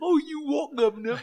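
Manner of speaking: high, loud hammed-up Cockney geezer; over-the-top Cockney parody; mock Cockney accent. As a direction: sneer it out in an exaggerated Cockney accent. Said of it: "like, the Cockney accent".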